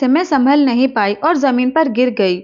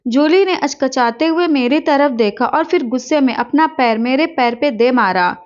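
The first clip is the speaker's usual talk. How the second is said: ordinary delivery, nothing marked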